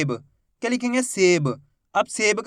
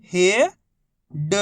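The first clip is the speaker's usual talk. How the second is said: each syllable held, long pause between; first syllable rising, then a separate second; much slower than usual. Shown as a demonstration. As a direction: sound it out for beginning readers. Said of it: "एक ही अक्षर का उच्चारण करके".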